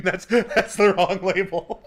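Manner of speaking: laughing through the words, slowed; real laugh breaking the line. Real, not acted. Laughs at own slip.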